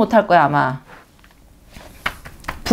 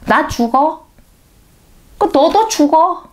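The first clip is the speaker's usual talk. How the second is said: spiteful threat; 7 semitones higher, slower than usual. Retold or imitated. Voicing spiteful dying evildoer.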